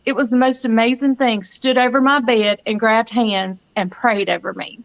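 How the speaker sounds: usual speaking voice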